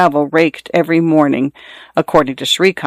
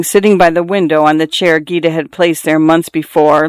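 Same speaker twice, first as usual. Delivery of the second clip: ordinary delivery, nothing marked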